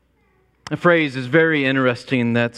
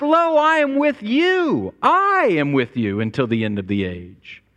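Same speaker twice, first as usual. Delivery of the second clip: solemn promise; 6 semitones higher than usual. Retold or imitated. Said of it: "he ends it with".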